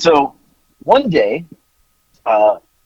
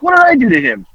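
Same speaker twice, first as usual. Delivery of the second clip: put-on high, put-upon whine of a wronged kid; higher, louder mock innocence; mock indignant kid; 8 semitones higher, 5 dB louder than usual. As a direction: play the innocent little jerk, voice pitched up. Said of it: "Nick's like".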